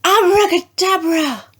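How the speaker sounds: high, ringing magic word; a witch's high spell shout. A narrator's reading. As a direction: cast the spell with a triumphant cry. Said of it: "stamped her foot and shouted".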